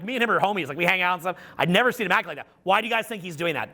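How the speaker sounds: ordinary delivery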